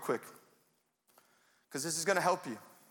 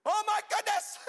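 mock despairing worrier; 4 dB louder than usual. Preacher mocking believers who complain the world is dark, in a hammed-up wail. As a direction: wail it like a hand-wringing doomsayer, much higher and louder. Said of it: "stop saying".

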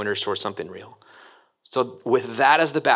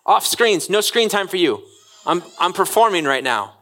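put-on bossy kid performer; 7 semitones higher than usual. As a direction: play the child scolding Dad to put the phone away. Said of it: "they're like".

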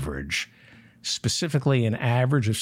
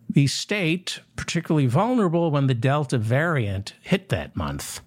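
normal talking voice

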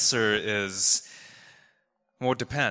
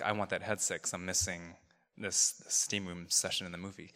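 low-volume resentful inner voice; a mild mock grumble under the breath; 3 semitones lower, 9 dB quieter than usual. Preacher voicing the passenger's hidden resentment, quiet and muttered. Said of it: "underneath he's like".

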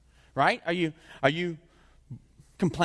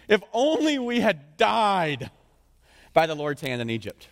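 drawn-out mock lament, higher and louder; whiny Israelites; 3 semitones higher, 4 dB louder, slower than usual. Preacher voicing Israelites whining to Moses, mock lament. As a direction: ham up a self-pitying wish to have died.

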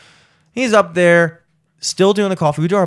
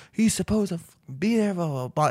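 high, soft imitation of tearful complaint; broken down; 6 semitones higher, 7 dB quieter than usual. Retelling breaking down over him, tearful. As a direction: whine it quietly as if breaking down. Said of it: "broke down".

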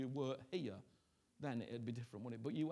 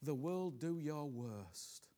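soft, low voice speaking as Jesus; hushed, tender voice of Christ's vow; quiet sermon in Christ's voice; quiet preaching as Jesus; 4 semitones lower, 6 dB quieter than usual. Acted.

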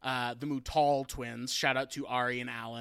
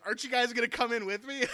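laughing through the quote; 7 semitones higher, faster than usual. Retold or imitated. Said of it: "he gets out and he goes".

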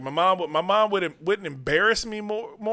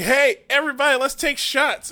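higher, louder party call from a tipsy mom; drunk mom cheering; 9 semitones higher, 6 dB louder than usual. Retold or imitated. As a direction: whoop it up like a drunk mom at a barbecue. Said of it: "Her mom's like".

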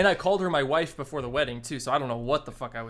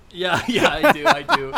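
high, loud, laughing through it; laughing yes; 7 semitones higher, 12 dB louder than usual. Acted. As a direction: give in with a laugh. Groom caves, laughing.

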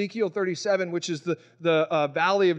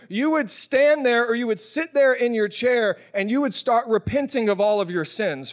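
normal talking voice

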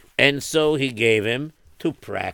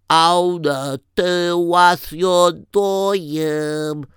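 high, slow, word-by-word prompting; coaching a toddler; 5 semitones higher, much slower than usual. Retold or imitated. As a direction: draw out each word for a child. Parents teaching hand-washing blessing.

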